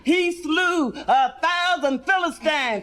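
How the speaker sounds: preacher voice. Acted. Preacher singing out to the congregation. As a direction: stretch it like a Sunday preacher. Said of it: "He preaching about Samson".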